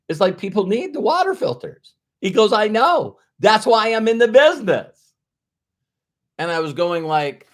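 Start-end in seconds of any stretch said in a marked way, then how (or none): 0.1-1.9 pitched-up, emphatic protest; retold emphatic insistence. Retold defence of water filters. 2.5-5.1 high, loud imitation of an emphatic reply; retold emphatic agreement.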